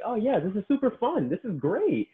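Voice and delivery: very high-pitched mock-cheerful voice; singsong, high mock delight; gleeful clients. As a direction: imitate clients suddenly loving it. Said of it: "and now they're like".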